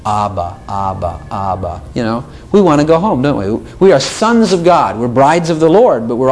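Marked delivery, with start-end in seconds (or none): 0.0-1.8 soft, low, yearning Abba; quiet tender pleading; soft longing cry. Retold or imitated. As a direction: murmur it like a child calling its father. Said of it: "always saying".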